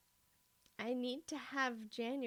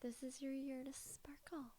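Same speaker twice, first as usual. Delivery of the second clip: mock whisper; 11 dB quieter than usual. Reading a cheesy quote.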